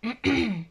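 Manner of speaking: clears throat to free the voice; throat clearing. Real, not acted. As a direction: clear the throat once, short. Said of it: "j'ai un truc dans la gorge".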